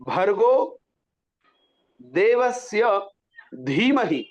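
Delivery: slow, separated words with aspirated भर्गो and धीमहि; clear articulation. Shown as a demonstration. Teacher models the mantra line, distinct धीमहि. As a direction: enunciate भर्गो and धीमहि with full puffs on bh and dh. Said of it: "स्पष्ट पूरा बिल्कुल".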